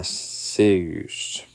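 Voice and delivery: "sauce" drawn out as "seuss"; altered pronunciation. Retold or imitated. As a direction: stretch "sauce" slowly into a different vowel. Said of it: "maybe these guys pronounce it different".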